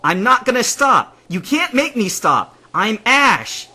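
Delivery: high, loud defiance; defiant yelling. A narrator's reading. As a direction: yell back, refusing to obey. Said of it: "Would you stop yelling?".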